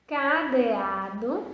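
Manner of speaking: each syllable drawn out slowly; slow careful reading. Shown as a demonstration. Teacher reading cadeado slowly to first graders. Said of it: "vou mostrar a primeira palavra".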